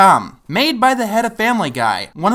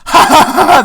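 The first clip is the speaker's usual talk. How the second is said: loud, higher forced laugh; fake sarcastic laugh; 7 semitones higher, 8 dB louder than usual. Fake laugh mocking the joke.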